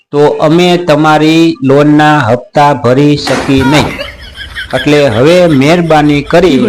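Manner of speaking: slow, exaggeratedly patient speech; mock calm. Shown as a demonstration. Uncle telling the bank to stop calling, slow and mock-patient. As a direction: spell it out slowly like a polite lecture. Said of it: "હાલો હવે નિરાયતથી વાત કરું છું".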